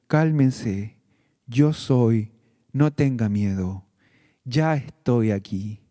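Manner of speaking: low, gentle reassuring voice of the Lord. Acted. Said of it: "la voz del Señor diciéndole".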